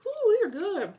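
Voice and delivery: high, loud delighted ooh; excited. Real, not acted. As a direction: burst out with delight at the taste. First bite of the candy.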